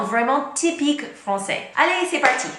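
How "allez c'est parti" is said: rally the viewers to start; let's go, pitched up with energy; excited kickoff. Real, not acted. Upbeat kickoff to the list.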